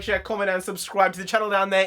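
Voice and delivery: normal talking voice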